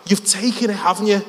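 high, accusing Scouse; Scouse accent. Retold or imitated. Lad accusing a stranger of theft, high Scouse. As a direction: press the accusation in thick Scouse. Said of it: "give you a glimpse of the scouse".

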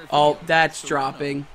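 ordinary talk, nothing marked

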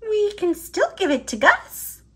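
high, fast cheering-up voice; Squeak voice, quick consoling. Acted. Squeak to Pip, cheering him up, in a high quick voice. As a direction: perk up a disappointed friend.

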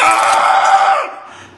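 sudden high shriek; startled yell. Acted. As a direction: shriek in fright. Startled by the toy.

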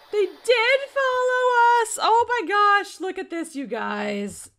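high, very loud, stretched exclamation; alarmed shriek to viewers; shocked shout. Real, not acted. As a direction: cry out in disbelief. Gnomes followed them.

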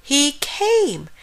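high, overjoyed announcement; squealing. A narrator's reading. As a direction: squeal it in thrilled disbelief. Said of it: "squealed Frog".

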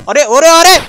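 high, loud alarmed cries; real panic shout; panicked yelps. Real, not acted. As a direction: yell it as you start to fall. Character about to fall.

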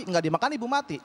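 usual speaking voice

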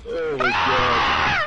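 slow, high, loud drawn-out shout. Real, not acted. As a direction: bellow it out in shock. Shouted exclamation.

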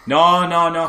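ordinary talk

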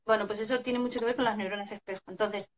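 ordinary talk, nothing marked

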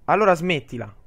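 a loud, hammed-up telling-off; playfully barking at a crying girl to stop; mock stern order. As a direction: snap it like a fed-up heroine. Picks harsh dialogue option, mock.

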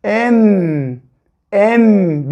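Em said as one syllable, E held into a humming M; blended syllable em. Shown as a demonstration. Teacher showing children how E and M join. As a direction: hum the M right after the E. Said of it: "E'nin sonuna M gelirse nasıl okuruz".

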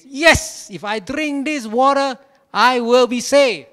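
high, loud and slow, fired up; ecstatic proclamation. Retold or imitated. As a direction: leap up and proclaim it with joy. Inspired pastor jumping up.

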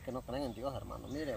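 usual speaking voice

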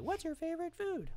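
very high-pitched mock teacher; teacher's question. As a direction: put on a high little classroom voice. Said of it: "answer that you always give your teacher, like".

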